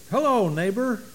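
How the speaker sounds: a hint of a children's TV host saying hello; Fred Rogers greeting. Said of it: "I can't use Fred Rogers' accent".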